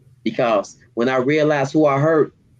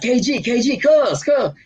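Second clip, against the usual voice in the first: much higher, eager calling of a name; excited calling out; 10 semitones higher than usual. Retold or imitated. Retelling how he called his cousin at the bars, excited. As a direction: call to your cousin across the cellblock.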